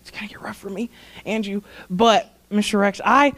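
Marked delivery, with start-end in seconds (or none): 0.0-1.6 real tearful near-whisper, very soft; tearing up. Real, not acted. Speaker warning Rex both will tear up, choked whisper. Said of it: "I'm gonna tear up".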